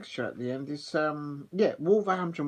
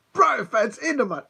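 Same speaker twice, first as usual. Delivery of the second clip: gloating; 9 semitones higher, 8 dB louder than usual. Real, not acted. Gloating over a seven-nil score.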